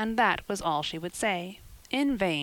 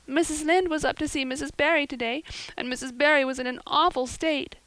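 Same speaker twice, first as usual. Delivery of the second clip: wailing; 7 semitones higher than usual. Acted. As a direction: wail it out, heartbroken. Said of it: "she wailed".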